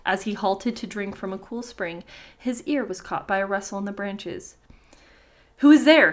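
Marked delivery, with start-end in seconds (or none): 5.6-6.1 loud, higher-pitched call; an exclamation thrown out loud; exclaimed question. A narrator's reading. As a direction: call out sharply to someone unseen. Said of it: "he exclaimed".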